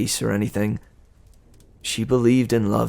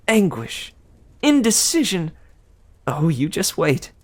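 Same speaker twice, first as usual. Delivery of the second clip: higher, sweet gossiping goddess; Aphrodite; 7 semitones higher than usual. Acted. Aphrodite teasing Percy about Annabeth, higher and sweet. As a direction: coo it like a doting goddess.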